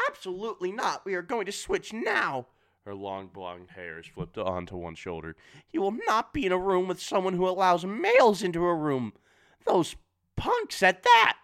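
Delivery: put-on overbearing mother shrieking about the boys. A narrator's reading. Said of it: "she shrieks".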